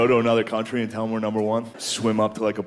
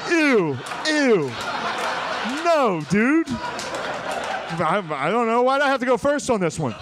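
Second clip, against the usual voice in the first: much higher, squeamish protest; a delegate recoiling, laughing; high, laughing mock disgust; disgusted congressman; 9 semitones higher, slower than usual.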